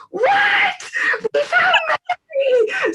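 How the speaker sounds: very high, cracking cry of joy; overjoyed shout. Retold or imitated. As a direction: burst out in disbelief, then shout the news slowly. Newton hears the answer he prayed for.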